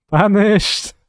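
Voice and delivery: loud, high gleeful shout; excited shout. Real, not acted. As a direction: crow it out loud. Reacting to a play on stream.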